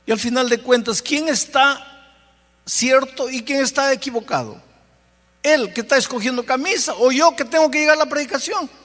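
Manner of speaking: más agudo, reclamando tener la razón; defensivo indignado. Retold or imitated. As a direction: quiere ganar la discusión. Discute consigo, defendiéndose. (pastor discutiendo con el Espíritu, indignado)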